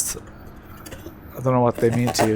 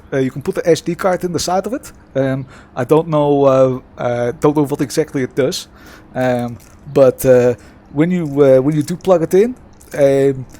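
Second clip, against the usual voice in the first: put-on Dutch accent. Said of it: "here we go in a bit of Dutch accent".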